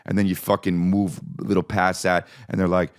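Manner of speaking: ordinary delivery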